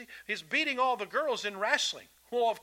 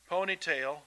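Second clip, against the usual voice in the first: normal talking voice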